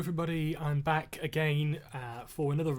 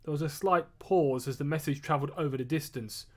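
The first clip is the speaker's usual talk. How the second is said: ordinary delivery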